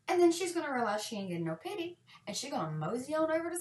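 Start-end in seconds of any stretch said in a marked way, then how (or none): none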